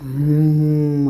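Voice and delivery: very slow, long "hmm" moan turning into a cry; drawn-out moan. A narrator's reading. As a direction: stretch the moan out like starting to weep. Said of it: "అని శబ్దం చేస్తూ నెమ్మదిగా ఏడడం".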